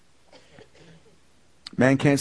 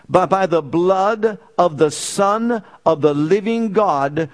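raised, louder preaching build; 5 semitones higher, 4 dB louder than usual. Acted. Pastor preaching redemption by blood to the church, fervent. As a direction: drive the point home to the congregation.

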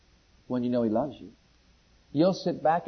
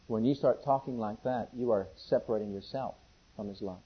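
low, soft pastoral delivery; hushed, earnest preaching; 5 semitones lower, 4 dB quieter than usual. Acted. Preacher telling believers they separate themselves, hushed and earnest. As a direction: bring the sermon down to a quiet personal appeal.

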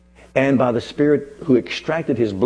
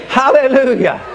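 exultant hallelujah; 11 semitones higher, 5 dB louder than usual. Real, not acted. Climax of congregational chant.

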